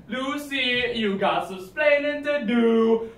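put-on scolding Cuban accent; Cuban accent Ricky. Ricky Ricardo catchphrase.

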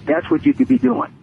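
ordinary delivery

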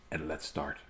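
very soft and low; hushed. Real, not acted. Reader taking a breath before starting the story, hushed. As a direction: settle down and say it quietly. Settling himself before reading begins.

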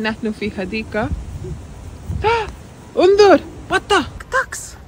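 high, lively picture-book voice; reading to a toddler. Acted. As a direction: point things out to a small child.

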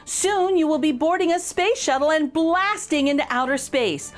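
plain reading voice

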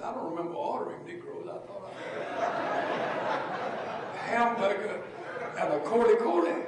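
laughing through the order, rising loud; chuckling as he orders again; amused retelling. Retold or imitated. Preacher tells the church his order, laughing.

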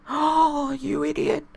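high, loud anguished yelp; shocked cry. Real, not acted. Gamer reacting to a keeper error, high shocked cry. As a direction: shriek at the blunder.

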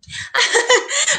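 a sudden high laugh breaking out; high burst of laughter. Real, not acted. Teacher laughs at a student's remark.

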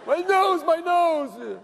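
high whiny wail, imitating a crybaby; hammed-up wailing over a hurt nose; mock crying. Steve crying after being punched, a high mock wail. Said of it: "you should have heard him cry".

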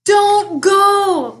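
loud, high wail. Acted. Bear throwing himself across the path, loud wail. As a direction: beg him not to leave, wailing. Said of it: "wailed Bear".

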